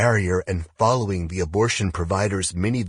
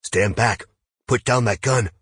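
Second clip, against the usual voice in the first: stern order, raised in pitch and volume; firm, louder, higher warning; hoarse stern command; 5 semitones higher, 5 dB louder than usual. A narrator's reading. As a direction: sternly order them off, voice lifted. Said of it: "in a hoarse voice sternly saying".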